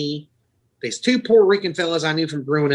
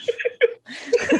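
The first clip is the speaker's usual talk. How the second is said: high breathless giggle; real helpless high laughter; laughing. Real, not acted. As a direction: keep cracking up, barely any voice. Still cracking up.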